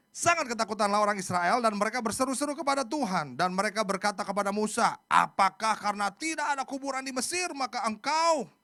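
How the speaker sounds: loud, high, dramatic Bible reading; scripture reading. Acted. Preacher reads Israel's protest to Musa, loudly declaimed. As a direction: declaim the frightened people's protest.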